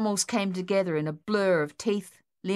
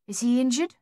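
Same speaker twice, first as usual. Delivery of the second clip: anxious question; 6 semitones higher, 4 dB louder than usual. Acted. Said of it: "their mother asked".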